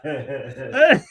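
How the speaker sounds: short real laugh; amused chuckle. Real, not acted. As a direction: laugh along. Laughs along.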